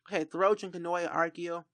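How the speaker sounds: usual speaking voice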